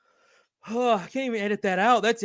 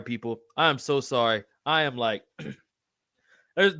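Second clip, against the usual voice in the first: usual speaking voice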